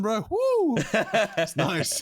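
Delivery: gleeful whoop. Real, not acted. Cheering about comfy dresses.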